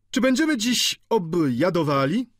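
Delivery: high-pitched joking shout; boisterous student. A narrator's reading. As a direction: call out jauntily, pitched well up. Medical student calls out at table.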